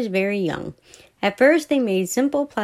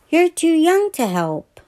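bossy big sister; 9 semitones higher than usual. A narrator's reading. Big sister telling Billy he's too young, bossy. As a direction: talk down to little brother.

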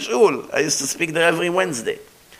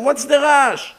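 dismissive quote; 8 semitones higher than usual. Retold or imitated. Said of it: "I said".

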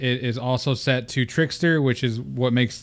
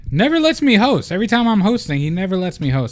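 indignant complaint; 8 semitones higher, 4 dB louder than usual. Real, not acted. Complaining brother won't let him host.

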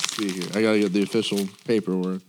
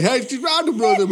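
put-on calling the kids in; 12 semitones higher, 5 dB louder than usual. Shown as a demonstration. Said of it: "You gotta say".

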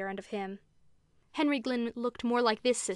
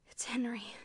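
softer, whispered on a sigh; 6 dB quieter than usual. A narrator's reading. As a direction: sigh the words out under the breath. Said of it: "Rebecca sighed rather than whispered".

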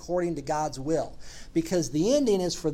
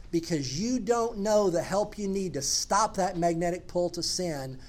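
ordinary talk

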